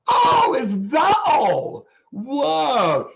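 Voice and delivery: mock-relieved cry, very high and loud; exaggerated relief. Retold relief at plane trouble.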